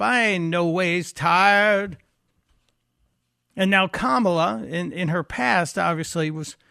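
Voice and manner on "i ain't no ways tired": slow drawled Southern preacher accent imitating Hillary; mock Southern accent. Host mocking Hillary's sudden accent, slow Southern drawl. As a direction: put on a Southern accent, slowly. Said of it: "have the accent that would suddenly show up".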